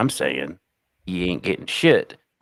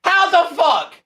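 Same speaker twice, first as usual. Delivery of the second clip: outraged shout, much higher; 3 dB louder than usual. Real, not acted. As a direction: yell in mock outrage. Calling the witch out live.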